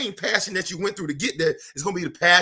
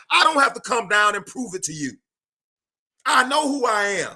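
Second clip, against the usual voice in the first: rising preacher's fervour, carried away; fired-up preaching; 8 semitones higher, 5 dB louder than usual. Acted. Preaching to his live audience about confidence, fired up. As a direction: preach it higher and louder, building.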